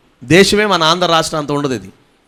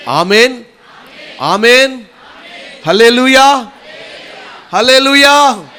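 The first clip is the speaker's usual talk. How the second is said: high, loud shouts building from amen to hallelujah; loud rallying call; 8 semitones higher, 7 dB louder, slower than usual. Real, not acted. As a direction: lead the congregation in ringing cries of praise. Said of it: "గట్టిగా ఆమేన్ చెప్పండి". (గట్టిగా ఉత్సాహపరిచే స్తుతి కేక)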